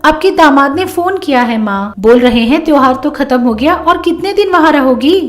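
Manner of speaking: put-on young married daughter reporting a phone call; daughter explaining. Voices the daughter Rani.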